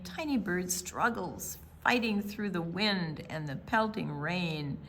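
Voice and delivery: ordinary delivery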